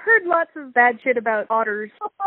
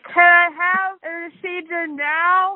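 slow, higher pleading whine; 6 semitones higher, slower than usual. Pretend. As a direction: beg like a fed-up patient. Patient begging for procedure.

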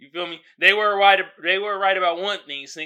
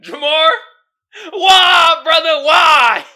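loud, high, disbelieving yell at a character; exasperated shout; 10 semitones higher, 16 dB louder, slower than usual. Real, not acted. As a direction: shout it up at the screen, pleading why. Yelling at a film character.

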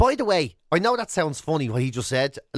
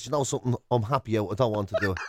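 retold reply; 8 semitones lower, 4 dB quieter, faster than usual. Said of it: "I says".

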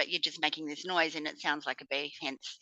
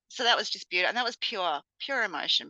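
ordinary talk, nothing marked

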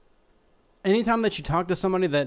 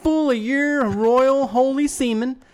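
mock preacher; 8 semitones higher, 4 dB louder, slower than usual.

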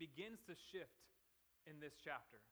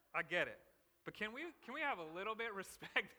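mock indignant rant; 6 semitones higher, 10 dB louder than usual. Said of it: "that's my rant".

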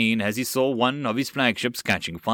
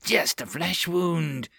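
slow, high goblin taunt; mad goblin; 6 semitones higher, slower than usual. Acted. Armless goblin taunting de John, slow high goblin voice. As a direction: drawl it out like a crazed goblin. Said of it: "The goblin giggled madly".

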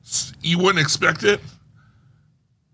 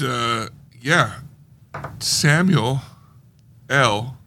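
ordinary delivery

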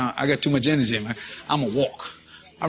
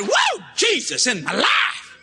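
high whoop, then drawn-out testimony; whooping praise; much slower than usual. Acted. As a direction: holler out joyful praise. Acting his praise shout in reply.

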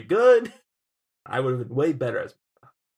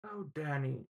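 soft hushed mock teacher voice; imitating teacher; 12 dB quieter than usual. Student mimicking teacher's sigh at his paper, soft mock voice. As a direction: sigh the name gently. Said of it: "that's not her voice".

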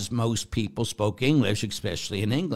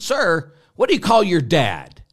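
loud, higher pointed question; retorting loudly; 7 semitones higher, 9 dB louder than usual. Retold or imitated. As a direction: fire the question back at the guard. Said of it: "And I said".

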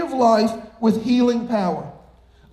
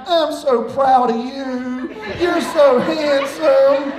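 slow, drawn-out Southern drawl, mock praise; southern accent; 2 semitones higher, much slower than usual. Kids mimicking dad in Southern accent. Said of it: "with a very southern accent".

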